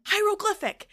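high, loud retold guess; acting out an urgent guess; shouted answer. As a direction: call the answer out insistently. Shouting answer at brother-in-law.

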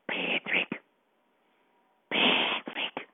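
eerie breathy whisper, soft and close; creepy whisper. Acted. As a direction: whisper like a peeping stalker.

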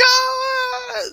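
long high mock wail; frantic cry, imitated. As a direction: imitate a frantic woman wailing. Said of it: "The girl's frantic".

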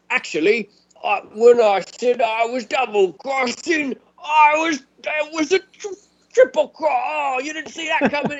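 high, whiny mock of a smug traitor; squeaky mocking impression of the double-crosser; mock gloating villain. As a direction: ham up the smug reveal, voice cracking high. Mocking triple-cross reveal.

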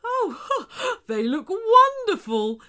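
high, laughing delight. Acted. Shark admiring new teeth, overjoyed. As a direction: marvel with a happy laugh.

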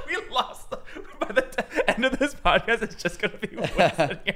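talking through giggles; laughing. Real, not acted. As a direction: laugh as you say it. Laughing at departed friend.

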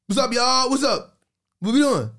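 high-pitched hype greeting to viewers; livestream greeting. Retold or imitated. Host greets his online audience on live, upbeat high greeting.

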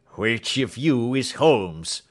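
slow, loud demand from an intruder; angry demand. Acted. Huge intruder confronts the two men, angry demand. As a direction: demand it loudly, each word drawn out.

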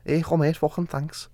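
high, soft mock Scouse; Scouse impression. Thanking a mate for the praise, joking Scouse accent. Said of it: "you're a talented kid".

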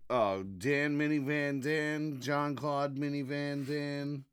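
normal talking voice

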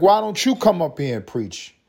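hammed-up preacher calling her up; a hint of a pushy preacher; mock preacher invite. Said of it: "And his tone".

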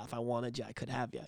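low, very soft imagined threat; imitated understated warning; quiet menace. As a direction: murmur it low, holding power back. Voices Vader holding back in battle.

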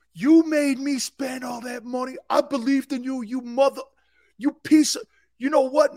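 high, loud furious tirade; furious shout. Pretend. A fan screams at the returning quarterback, furious. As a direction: scream at the player who wasted your money. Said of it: "I would do this".

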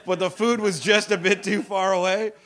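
ordinary talk

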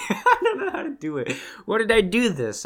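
giggling helplessly at the failed cork; laughing. Real, not acted. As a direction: laugh through the words at yourself.

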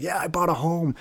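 mock YouTuber. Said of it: "YouTubers and stuff who will just say".

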